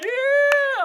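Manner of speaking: very high, loud cheering shout; a big mock crowd yell of joy. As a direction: cheer like a whole cafeteria. Even lost students cheer.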